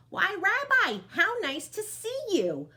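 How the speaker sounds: put-on high, loud, slowed old lady's welcome; old Baba Brena, delighted. Baba Brena welcoming her guest, high delighted old-lady voice. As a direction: greet the guest with bright surprise. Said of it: "Baba Brena said".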